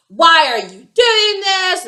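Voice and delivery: high, loud, drawn-out protest; imitated indignant outburst; mock outraged boyfriend. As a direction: act out his exasperated demand, stretching the words. Boyfriend's angry text, acted out.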